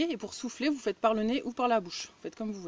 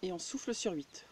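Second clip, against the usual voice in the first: guided breathing; 10 dB quieter than usual. Acted.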